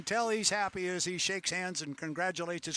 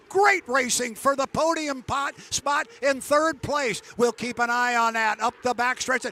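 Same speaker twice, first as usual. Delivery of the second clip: live play-by-play; 7 semitones higher, 11 dB louder than usual. Acted. Live race call.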